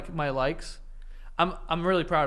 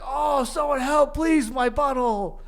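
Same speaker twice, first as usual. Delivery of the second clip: slow, high mock wail for help; cartoon guy's plea; 7 semitones higher, slower than usual. Cartoon guy begs for help, mock wailing. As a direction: plead in drawn-out fake agony. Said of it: "then next slide is like".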